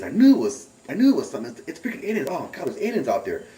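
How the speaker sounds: high, loud burst of vindication; retold excited realization. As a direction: act out the shock of finally knowing. Retold sudden realization of aliens.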